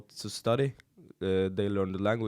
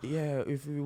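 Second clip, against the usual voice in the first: mock British accent; 4 semitones higher, 4 dB quieter than usual. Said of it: "I'm like, in your mind you speak like a British guy".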